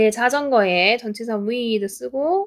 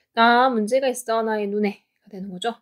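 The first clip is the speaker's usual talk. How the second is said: usual speaking voice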